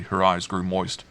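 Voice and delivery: ordinary delivery, nothing marked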